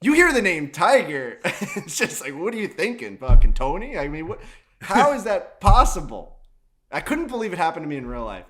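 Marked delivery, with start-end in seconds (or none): 0.0-6.4 loud, laughing mock outrage; laughing disbelief. Asking the kid how he missed Tiger, loud and laughing. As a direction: laugh while acting stunned at the kid.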